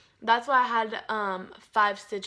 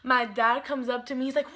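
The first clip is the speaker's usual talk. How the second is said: normal talking voice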